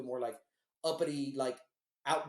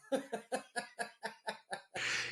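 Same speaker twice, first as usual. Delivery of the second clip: long high staccato chuckle; real drawn-out fit of giggly laughter; laughing; 6 semitones higher, 4 dB quieter than usual. Real, not acted. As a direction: crack up at your own punchline. Laughs at his own punchline.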